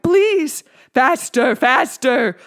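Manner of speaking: high, loud urgent commands; frantic shouts. A narrator's reading. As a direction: demand it relentlessly. Teacher screaming demands.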